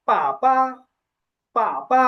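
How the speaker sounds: first "পা" falls, second "পা" light; falling tone then neutral. Shown as a demonstration. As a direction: let the first "পা" drop, then a toneless "পা". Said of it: "এই টোনের পার্থক্যটা".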